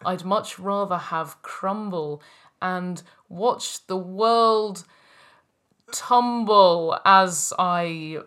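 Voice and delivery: rhythmic, emphatic performance poetry; slam poetry. Acted. Performing a jelly slam poem for friends, rhythmic and emphatic. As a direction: perform it like a slam poet, leaning into the rhymes.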